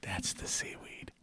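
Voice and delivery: hushed whisper into the mic. Real, not acted. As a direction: breathe it out, barely voiced. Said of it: "Wait, listen".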